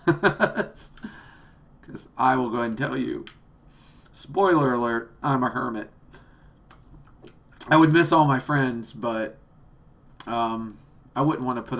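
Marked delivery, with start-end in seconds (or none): none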